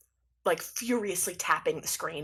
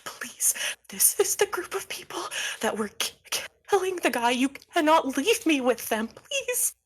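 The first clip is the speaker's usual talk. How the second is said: higher, breaking into whispery begging; terrified pleading; 8 semitones higher than usual. Acted. Cosmo begs jumpsuit guy not to leave them, terrified. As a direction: beg as if you've seen a ghost. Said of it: "they've seen a ghost".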